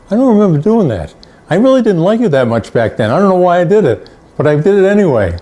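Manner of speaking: high, quick mock Lobel voice; teasing Lobel. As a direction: play him brushing off the thanks with a grin. Retelling what Lobel says now.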